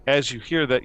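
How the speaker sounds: ordinary talk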